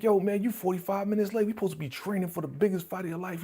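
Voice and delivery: high, fast nagging from a buddy; imitating a fed-up trainer friend; scolding friend. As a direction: chew him out for showing up late. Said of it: "He's like".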